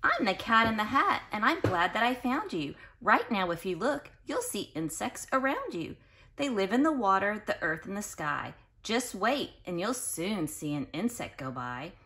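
put-on reading to children. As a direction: read it out to the class with bounce. Reads Cat in the Hat book aloud.

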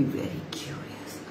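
hushed whisper. A narrator's reading. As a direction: whisper it with suspense. Story suspense.

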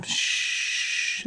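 put-on quiet the baby gently; soft shush. Mother hushing baby.

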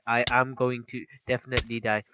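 normal talking voice

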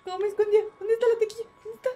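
high, loud, frantic panic, voice rising; panicked cries. Real, not acted. Streamer hiding from a killer in the game, high and frantic panic. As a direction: scream it as if being chased.

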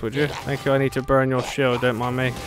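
ordinary talk

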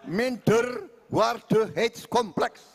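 drawn-out Dutch in Banyumas Javanese accent. Shown as a demonstration. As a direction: lay on the Banyumas accent over each Dutch word. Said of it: "bahasa Belanda dengan logat Banyumas".

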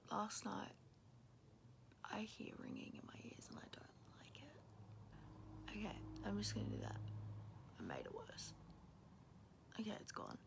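soft early-morning whisper; hushed, half-asleep morning whisper. Real, not acted.

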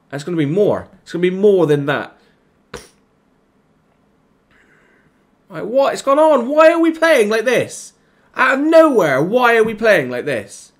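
very high, loud, exasperated; furious yelling. Real, not acted. Gamer screaming at his team's collapse, furious. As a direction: scream it at the team. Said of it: "I'm just getting annoyed now".

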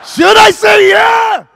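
full high-pitched shout, drawn out; huge slow rousing yell; building catchphrase. Shown as a demonstration. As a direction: yell it slowly at the crowd.

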